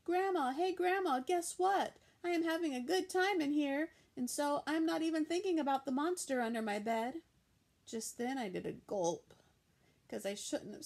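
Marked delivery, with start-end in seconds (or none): 0.0-7.2 high, loud happy calling; girl calling cheerfully to grandma. A narrator's reading. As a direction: call out to grandma in the next room.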